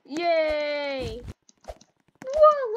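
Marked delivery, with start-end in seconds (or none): 1.7-2.8 high, loud falling whoa; loud high thrilled whoa; excited whoa. Real, not acted. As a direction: gasp it out in delight. Starts dancing at party.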